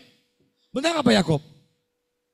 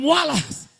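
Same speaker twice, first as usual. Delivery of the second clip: "Malas" called out louder and higher on the count; on-cue shout; 8 semitones higher, 6 dB louder than usual. Shown as a demonstration. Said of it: "Ulang, satu, dua, tiga". ("Malas" diteriakkan setelah hitungan)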